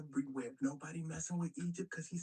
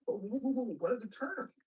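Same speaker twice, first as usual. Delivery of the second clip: louder, higher stammered interruption; mock alarm; 4 semitones higher, 4 dB louder than usual. Brother halting Caesar to ask the terms, mock alarm. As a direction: stammer as if hurriedly halting the deal. Brother suddenly questions the deal.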